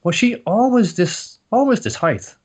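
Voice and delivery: high, slow wondering; startled thought. Retold or imitated. Son's startled inner thought.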